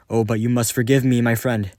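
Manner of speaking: fast, loud mock courtesy; false cheer. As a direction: toast him with fake warmth.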